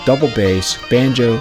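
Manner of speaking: plain reading voice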